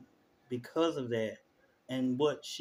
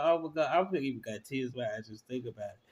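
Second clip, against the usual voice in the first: ordinary talk, nothing marked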